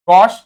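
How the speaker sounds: गॉश with the vowel of हॉकी; pronunciation model. Shown as a demonstration. Teacher compares गॉश to हॉकी for the students. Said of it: "जैसे हॉकी में हॉ है ना".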